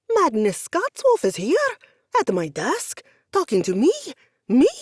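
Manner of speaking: loud, high, disbelieving gush; fanboy losing it; starstruck gushing. Acted.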